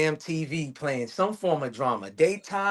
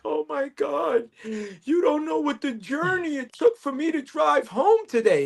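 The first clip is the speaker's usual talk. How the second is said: put-on much higher, slow, over-the-top dramatic girlfriend; dramatic woman; 11 semitones higher than usual. Mocking women over a drive home, high melodramatic voice. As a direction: ham up a woman making a huge story of nothing. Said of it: "Y'all make that shit like".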